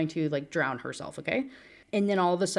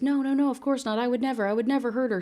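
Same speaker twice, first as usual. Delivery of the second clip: higher, fast, panicky denial; frantic denial; 7 semitones higher, faster than usual. Acted. Caleb denies hurting Dawn to the friends, fast frantic denial.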